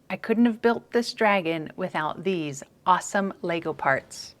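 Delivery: ordinary talk, nothing marked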